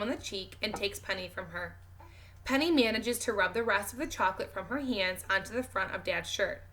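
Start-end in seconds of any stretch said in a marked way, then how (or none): none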